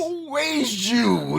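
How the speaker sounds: high, drawn-out whale-song question; comic whale voice, very high and stretched; mock whale call. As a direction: wail it slowly like a judgmental whale. Other whales mock the odd whale.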